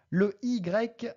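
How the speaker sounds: ordinary talk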